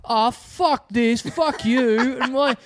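high-pitched, stammering outrage; imitated petulant complaint; indignant punter. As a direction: protest like a wronged customer, voice pushed up. Acted quarrel with angry punter.

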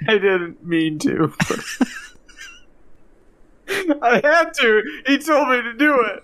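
high, whimpering mock sobs; hammed-up tearful squeal; crying. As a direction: blubber out a guilty confession. Confessing tearfully to killing Santa.

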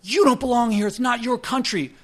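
high, loud mock yell at a stranger; a stranger yelling at a man outside a bar, loud and high; retold yell. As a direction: shout it like an angry drunk. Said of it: "the guy's yelling at the guy".

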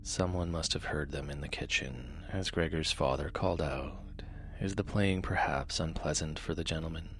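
soft, low bedtime reading; sleep story narrator. A narrator's reading. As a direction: read gently to lull listeners to sleep.

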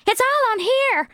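put-on very high, excited girlish voice; eager witness. The chunky girl showing her phone, very high and excited. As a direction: wave the phone, thrilled.

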